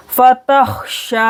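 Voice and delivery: फतहशा slow, heavy throaty kh and long shaa; फतहशा with a thick kh and held final vowel; thick kh, stretched shaa. Shown as a demonstration. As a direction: draw out the shaa ending of फतहशा. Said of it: "शीन को इस तरह से खींच कर पढ़ेंगे".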